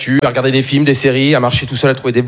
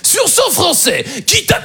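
high, drawn-out game-show host call; Jean-Luc Reichmann; 12 semitones higher, much slower than usual. Acted. [name] on the phone doing his TV host imitation, high and drawn-out. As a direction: stretch each phrase in a high, showy put-on voice. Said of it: "il aime bien faire des imitations".

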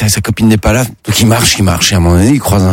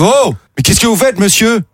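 much higher squeaky cop voice; mock startled cop; 12 semitones higher than usual. Police catching a man with the pumpkin, squeaky and shocked. Cops catch him, high voice.

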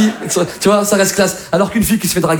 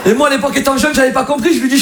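ordinary talk

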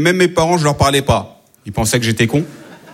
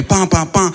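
siren imitation; 4 semitones higher, much slower than usual. Shown as a demonstration. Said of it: "ça fait".

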